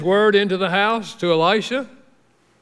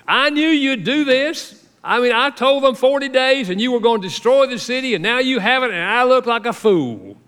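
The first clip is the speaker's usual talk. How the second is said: sulky prophet, pitched high and pushed louder; angry Jonah; 8 semitones higher, 4 dB louder than usual. Retold or imitated. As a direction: play Jonah fuming that he looks like a fool. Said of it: "greatly displeased and very angry".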